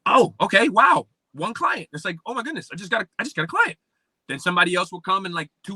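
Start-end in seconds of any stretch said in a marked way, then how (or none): 0.1-1.9 high, loud, drawn-out retold astonishment; amazed. Reliving landing a first client. 2.2-3.8 high-pitched replay of excitement; thrilled disbelief. Retold or imitated. Reliving landing a first client.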